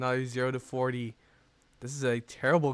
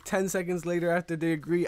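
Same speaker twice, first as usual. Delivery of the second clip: usual speaking voice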